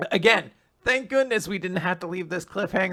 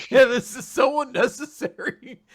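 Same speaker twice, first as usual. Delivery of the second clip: loud, laughing outrage; laughing complaint; 4 semitones higher, 8 dB louder than usual. Retold or imitated. Hero scolds Ziggy about the clothes, laughing. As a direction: protest through the giggles. Hero's line while laughing.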